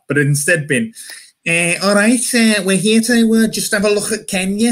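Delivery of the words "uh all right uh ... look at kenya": high-pitched mock Scouser; Scouse accent. Scouse colonizer casually dropping in on Kenya, Scouse accent. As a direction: put on a higher, punchy Scouse voice.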